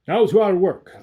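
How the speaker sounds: loud harsh shout of a sinister alchemist; harsh grating Coppelius. A narrator's reading. Coppelius to the father, harsh and grating. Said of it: "with a harsh, grating voice".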